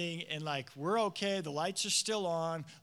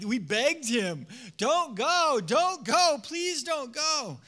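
louder, higher, slowing into a beg; pleading; 6 semitones higher, 8 dB louder, much slower than usual. Acted. Disciples begging Paul not to go, pleading. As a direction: hold on to a friend who is leaving.

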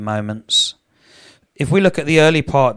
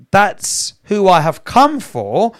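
rising full-force sermon voice; fervent preaching; 5 semitones higher, 5 dB louder than usual. Acted. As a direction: drive each word home to the congregation. Preaching Jesus' words at full force.

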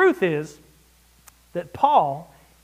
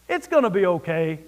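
breezy mock reassurance; 4 semitones lower than usual. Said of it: "Are you kidding?".

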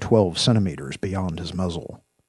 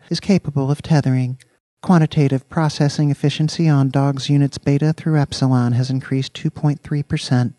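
higher, louder synthetic AI voice; computerized female voice; 8 semitones higher, 6 dB louder than usual. A narrator's reading. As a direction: report like a precise machine. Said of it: "The computerized female voice responded".

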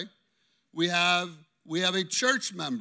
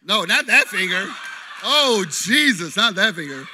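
much louder startled correction; loud laughing protest; 9 dB louder than usual. Real, not acted. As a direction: cry out at the wrong finger. Volunteer shows wrong finger.

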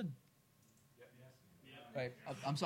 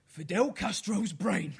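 loud, slow Cockney riddle; hammed-up Cockney accent; 4 semitones higher, 5 dB louder than usual. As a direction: spin it out in thick Cockney. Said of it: "do it in a Cockney accent".

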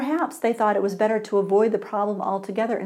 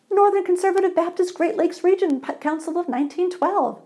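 eager; 9 semitones higher, 4 dB louder than usual. Acted. Other man's answer.

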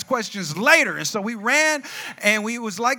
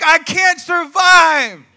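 loud, high, drawn-out cry; mock desperate wail; 7 semitones higher, 10 dB louder, much slower than usual. Teen terrified of jail, mock desperate wail. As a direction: wail it slowly in comic despair. Retells tearful fear of jail.

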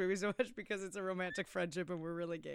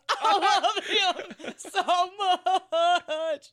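slow, drawn-out, laughing gush, higher and louder; 9 semitones higher, 8 dB louder, much slower than usual. Retold or imitated. Imitating the girlfriend gushing about him, slow and laughing. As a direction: stretch it out through giggles. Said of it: "looks at him again and goes".

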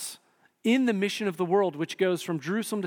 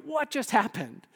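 higher-pitched baffled question; mock bewilderment; 5 semitones higher than usual. Voicing someone whose partner suddenly diverts, baffled. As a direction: act thrown by a sudden turn. Acting out reaction to betrayal.

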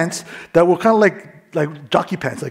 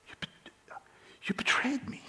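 hushed whisper, much softer; whispered betrayal; 8 semitones higher, 16 dB quieter, slower than usual. Retold or imitated. Beaten teen thinking of the girl, hurt whisper. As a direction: breathe the words out in a hurt whisper. Inner thought while being beaten.

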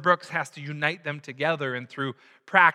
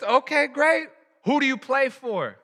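high, loud coach's challenge; acted coach demanding an answer; coach calling out; 12 semitones higher, 5 dB louder than usual. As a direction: fire the question across the rink. Coach calls back to player.

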